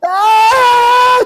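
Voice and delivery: very high, loud mock scream; sustained imitated wail; young man's howl. Young man breaking down in the street, high loud howl. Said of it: "Y el muchacho es así".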